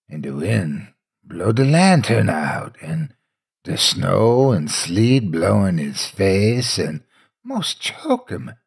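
usual speaking voice

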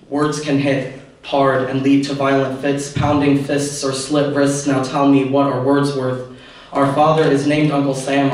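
rhythmic, measured spoken-word delivery; slam poetry recitation. Acted. Student performing poem for event audience, slam poetry recitation.

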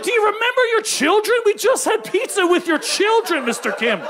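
scandalised. Retold or imitated. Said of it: "And I was like".

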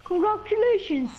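high, loud congratulating cheer; hearty congratulations. Acted. Friend congratulating the angler on the catch, hearty cheer. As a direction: call it out high and proud.

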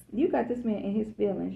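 ordinary delivery

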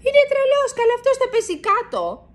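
high, loud alarmed cry; a bird warning that he'll fall; shouting. A narrator's reading. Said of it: "φωνάζει η Σούλα".

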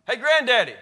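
shouting across to a hard-of-hearing grandfather; shouting to grandpa. Retold or imitated. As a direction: yell it so a deaf old man hears. Calling to deaf grandfather.

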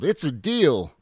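loud, high delighted yell. A narrator's reading. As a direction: burst out in happy agreement. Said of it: "The Minosaur yelled".

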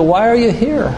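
ordinary delivery

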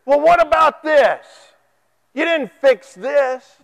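high, loud, slow griping at God; a hammed-up ungrateful prayer; demanding complaint. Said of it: "you'd say".

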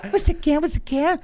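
high reedy falsetto with a laugh in it; thin high voice. Retold or imitated. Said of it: "ele fez uma voz fina".

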